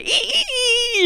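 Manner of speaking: very high squeaked "ee" pulses; imitated squeaky blade at work; cutting noise imitation. Wart cutter starting on patient, high repeated squeaks. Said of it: "And so they".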